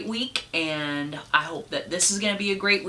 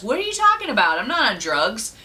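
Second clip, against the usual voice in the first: high, loud outraged denial; indignant bear; 6 semitones higher, 5 dB louder than usual. Acted. Bear protesting to the bartender, high and indignant. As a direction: squeal it like a bear insulted. Bear denying drug use.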